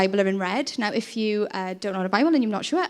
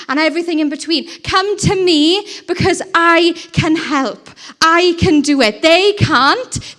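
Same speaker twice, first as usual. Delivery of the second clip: loud, slow, higher voice of Jesus calling out; Jesus pleading, preached loud; 5 semitones higher, 6 dB louder than usual. Acted. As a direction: preach it as Jesus urging them to come to him. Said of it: "No, he says".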